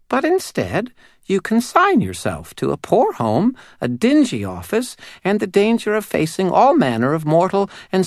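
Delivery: high, tiny, eerie doll voice; a small uncanny doll spirit speaking. Acted. Doll's long questioning speech.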